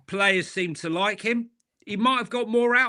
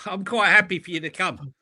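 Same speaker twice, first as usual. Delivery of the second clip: ordinary talk, nothing marked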